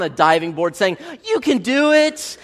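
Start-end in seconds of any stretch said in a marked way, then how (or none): 1.2-2.4 high, slow cheerleader encouragement; mock cheerful coach call; encouraging voice. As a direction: egg them on from the pool deck. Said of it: "some real encouraging voice".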